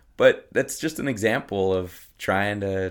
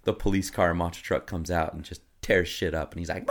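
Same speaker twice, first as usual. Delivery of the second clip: usual speaking voice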